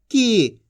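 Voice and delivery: की with the ī held long; की said as a long, sustained "kee"; long drawn-out vowel. Shown as a demonstration. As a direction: stretch the vowel in की. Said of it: "खींच के पढ़ा जाता है".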